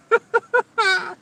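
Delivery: high, loud cackle; laugh. Real, not acted. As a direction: burst into a high-pitched laugh. Laughs after ending the story.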